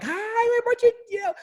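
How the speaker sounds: very high, singsong cheery greeting; happy neighbor. Retold or imitated. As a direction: imitate a delighted gift-bearer at the door. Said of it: "she was so happy".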